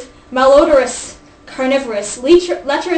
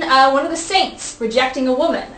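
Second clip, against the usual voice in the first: ordinary talk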